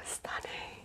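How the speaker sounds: breathy hushed whisper of awe; awed whisper. Real, not acted. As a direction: whisper it like it's precious. Awed over the edition.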